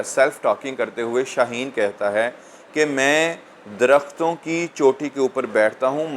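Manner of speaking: normal talking voice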